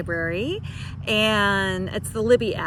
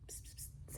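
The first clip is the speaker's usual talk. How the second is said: soft whispered psst sounds to a pet; cat-calling psst; 27 dB quieter, faster than usual. Real, not acted. Trying to get her cat to look at the camera, whispered psst calls. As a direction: hiss a quiet psst-psst to get the cat's attention.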